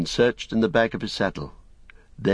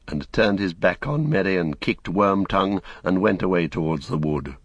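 ordinary delivery, nothing marked

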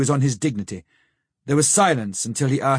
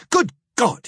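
high startled outburst of alarm; shocked cry; 10 semitones higher than usual. A narrator's reading. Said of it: "he cried".

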